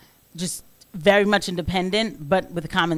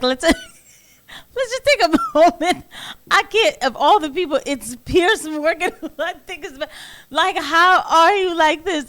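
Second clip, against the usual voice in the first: laughing in disbelief; 9 semitones higher than usual. Real, not acted. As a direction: crack up and try to get words out. Host cracks up at clip.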